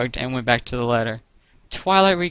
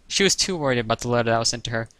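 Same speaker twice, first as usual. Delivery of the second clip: ordinary delivery, nothing marked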